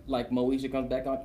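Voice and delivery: ordinary talk, nothing marked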